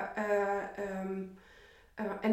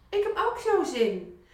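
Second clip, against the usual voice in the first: excited realisation; 7 semitones higher, 3 dB louder than usual. Retold or imitated. Repeated, higher.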